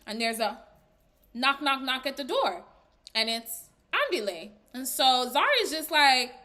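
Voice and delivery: ordinary talk, nothing marked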